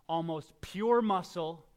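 ordinary talk

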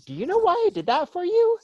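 put-on dad quizzing his kids about the yogurt, very high; teasing dad question. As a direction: lead the kids on, pitch flying up. Dad asking kids leading question.

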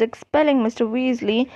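ordinary delivery, nothing marked

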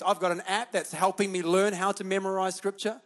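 ordinary delivery

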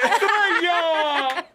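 slow stretched-out name; drawn out. Pretend.

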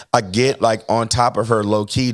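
ordinary talk

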